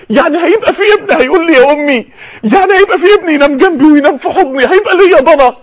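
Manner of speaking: high, excited mother-to-be; Sarah's amazed joy. Acted. Acting Sarah overjoyed at a child.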